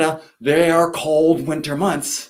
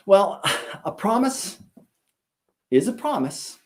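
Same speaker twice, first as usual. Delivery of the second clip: ordinary delivery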